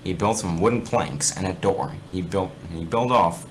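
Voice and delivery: a lightly dramatic reading of a spooky fanfic; story narration. Acted. Reading fanfic aloud to gaming friends, storyteller voice. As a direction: read it like a campfire tale, lightly.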